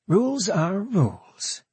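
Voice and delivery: high, slow and self-satisfied; smug. Acted. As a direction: savor the rule, smug guard. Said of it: "Kayla said smugly".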